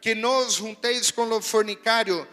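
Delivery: loud, slowed preaching read of the verse; forceful scripture reading. Acted. Preacher reading Corinthians to the congregation, slow and loud.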